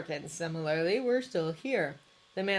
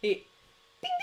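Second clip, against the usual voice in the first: ordinary delivery, nothing marked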